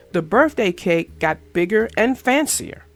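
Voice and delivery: ordinary delivery, nothing marked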